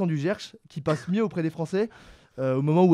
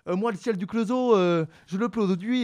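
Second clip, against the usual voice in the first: put-on drawn-out, higher Le Creusot Burgundy accent; mock Le Creusot accent; 4 semitones higher, 3 dB louder than usual. Debater caricaturing Le Creusot, slow Burgundy accent. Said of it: "en passant pour la Bourgogne ou du Creusot".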